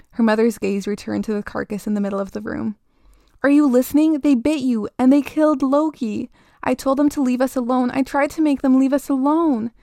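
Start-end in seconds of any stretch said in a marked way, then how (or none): 3.4-9.7 put-on higher, louder distraught child; desperate child insisting on being heard; containing sobs. A narrator's reading. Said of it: "struggled to contain the sobs".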